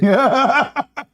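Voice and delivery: high-pitched burst of laughter; laughing. Real, not acted. Debater after his university joke, high laugh. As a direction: laugh at your own joke.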